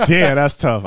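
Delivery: loud, high exclaim of dismay. Retold or imitated. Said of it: "I was like".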